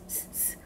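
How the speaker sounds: whispered "스, 스," like a snake; snake hiss. Shown as a demonstration. Teacher hisses the s sound like a snake for students. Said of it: "뱀이 지나가는 듯한 소리".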